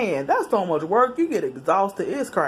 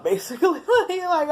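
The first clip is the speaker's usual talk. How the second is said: laughing through the words; 12 semitones higher than usual. Real, not acted. Admitting reckless driving, laughing. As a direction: chuckle as you admit it.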